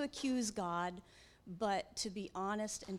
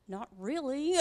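grudging reply; 5 semitones higher, 5 dB louder than usual. Retold or imitated. Grumpy reply to job offer.

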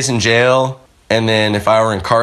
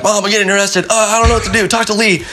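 high, frantic plea to mom; pitched-up panic on the phone to mom; panicked; 10 semitones higher, faster than usual. Retold or imitated. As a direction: sound scared and helpless.

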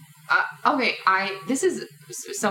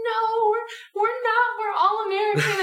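much higher imitation of audience members; high-voiced denial. Audience members denying they are from England, high-voiced protest. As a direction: pitch it right up in mock protest.

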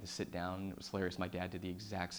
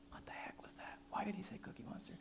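hush, puzzled inner voice; whispered disbelief; 11 semitones higher, 12 dB quieter than usual. Retold or imitated. Confused brother left in hospital, whispering. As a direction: whisper it, baffled.

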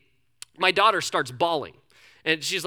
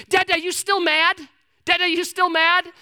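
put-on high, louder little girl asking; child's voice; 10 semitones higher, 5 dB louder than usual. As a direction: play his small daughter, pitched high. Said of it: "she goes".

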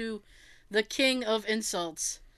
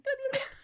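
high little gobbling blips; badly faked high turkey call; turkey impression; much faster than usual. Shown as a demonstration. Host readies turkey impression for Thanksgiving, faked gobble. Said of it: "my turkey impression".

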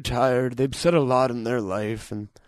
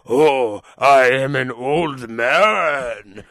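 put-on very slow, louder old man voice; Japanese old man; 5 dB louder, much slower than usual. Showing the Japanese version of an old man, very slow. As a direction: drag it out like a Japanese grandpa. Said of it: "their version of that is like".

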